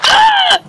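sudden high yelp of fright; startled scream. Real, not acted. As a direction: shriek at the surprise. Alien appears in game.

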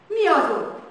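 general's furious shout at the school; angry shout. A narrator's reading. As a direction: bark it at the flag. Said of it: "Dühösen felkiáltott".

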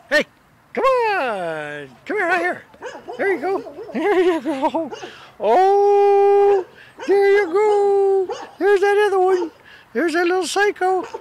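high singsong praise, drawn out; pet coaxing. Real, not acted.

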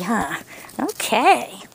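normal talking voice

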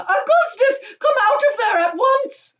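high, loud, drawn-out scolding yell. A narrator's reading. Mother orders son out of pipe, yelling. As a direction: yell up at the stuck boy. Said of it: "yelled Missus Gloop".

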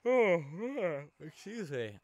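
high, loud yawn into an apology. Real, not acted. Gamer excusing himself to viewers, yawning. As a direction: talk out of a real yawn. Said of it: "I keep yawning".

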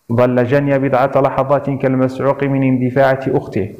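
usual speaking voice

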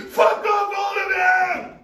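screaming rage, pitch way up; furious scream. Acted. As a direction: scream them all away. Screaming at everyone.

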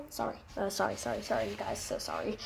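hushed, embarrassed sorry; quiet apology. Real, not acted. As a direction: murmur the apologies under breath. Apologising after child interrupts.

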